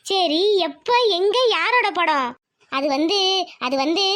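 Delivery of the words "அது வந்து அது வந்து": low-pitched, halting stall; hedging, with pauses and a trailing lift; hesitant drawl. Acted. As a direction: drag out the words, reluctant to explain. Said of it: "என்னடி வந்து போயின்னு இழுக்கிற".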